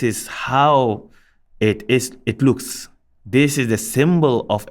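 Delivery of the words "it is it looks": ordinary talk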